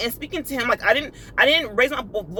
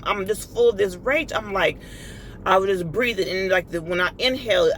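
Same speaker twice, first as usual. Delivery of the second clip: ordinary talk, nothing marked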